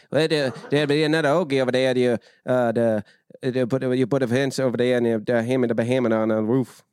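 mumbled, garbled Oklahoma talk; middle-of-Oklahoma accent. Retold or imitated. Imitating an Oklahoma welder, garbled rural accent. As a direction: run words together, rural Oklahoma. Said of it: "he would talk like".

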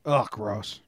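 disgusted groan, low and soft; annoyed ugh. Real, not acted. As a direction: recoil in disgust. Disgusted at snake cuddling.

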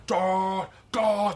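high mimicked whoosh of forced air; imitated air blast. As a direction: imitate air shooting into a mouth, high-pitched. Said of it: "air's just shooting in her mouth".

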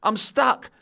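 high, pleading I'm stuck; helpless call. Retold or imitated. Boy stuck on the fence calling down, high and helpless. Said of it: "I said".

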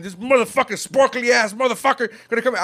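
high, reenacted angry rant; super angry. Fan reliving his anger at the Twilight actor's casting, super angry. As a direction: spit the insults out in mock outrage. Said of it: "I'm super angry".

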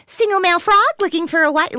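high, loud frog voice; cartoon frog. Dubbing a German animated frog commercial, high cartoon voice. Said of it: "like, the frog".

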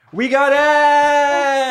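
drawn-out high triumphant yell; excited shout. Real, not acted. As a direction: cheer it out slowly. Celebrating with confetti cannons.

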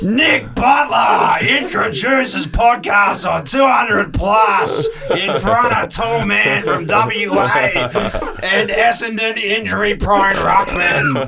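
slow, high, shouted headline read; announcer voice. Pretend. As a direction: bellow it like a hammy sports announcer.

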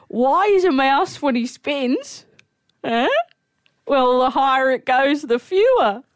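high-pitched, slow setup as an amateur comic; old fella's stage joke. Retold or imitated. Old fella telling his joke on stage, hopeful mock comic. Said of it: "This little old fella gets up on stage".

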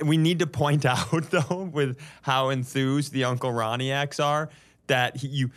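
ordinary talk

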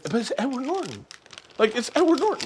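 hushed but high-pitched excitement; pleading fan protest. Retold or imitated. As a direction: plead your excuse in a small high voice. Retold protest after being calmed down.

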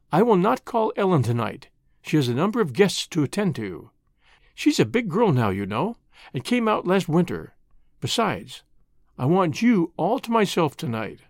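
ordinary delivery, nothing marked

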